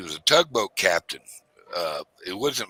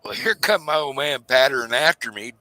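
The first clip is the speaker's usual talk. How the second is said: ordinary talk, nothing marked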